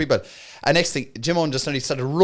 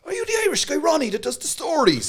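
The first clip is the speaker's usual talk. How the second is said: high, eager Are you the Irish guy; a fan suddenly recognizing him, pitched way up; excited high recognition; 12 semitones higher than usual. Retold or imitated. Jim Owen recognizing the comedian in the green room, high and eager.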